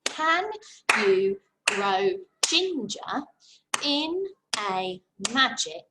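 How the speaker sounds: slow, syllable by syllable with beats; clapping rhythm. Shown as a demonstration. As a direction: clap out each word slowly. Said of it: "Let's clap it".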